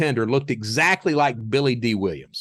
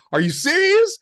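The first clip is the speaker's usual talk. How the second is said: stunned disbelief; 4 dB louder than usual. Retold or imitated. Stunned agent's question.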